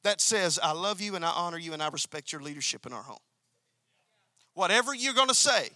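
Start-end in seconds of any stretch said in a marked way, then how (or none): 0.6-3.2 quicker, softer, tender respect; loving, honoring tone. Shown as a demonstration. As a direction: say it full of love and honor, a bit softer. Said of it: "laced with this attitude".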